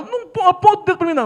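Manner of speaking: high, loud warning; father telling the woman not to point at him, loud; angry warning. Retold or imitated. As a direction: snap at her not to point. Father warns her, heated.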